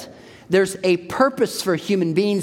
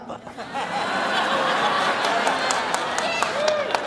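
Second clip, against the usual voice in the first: high laugh; 13 semitones higher, 4 dB quieter than usual. Real, not acted. Laughs at own joke.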